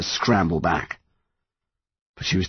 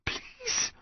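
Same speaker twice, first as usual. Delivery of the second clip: hushed, breath-only plea; desperate whisper; 12 dB quieter than usual. A narrator's reading. Son begging to himself under strain, whispering. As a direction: whisper it to yourself. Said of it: "Connor whispered to himself".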